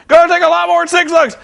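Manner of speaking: high, loud mock shout; indignant wrestler. Jeff Jarrett defying Eric Bischoff, high hammed-up shout. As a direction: ham up an outraged veteran's protest.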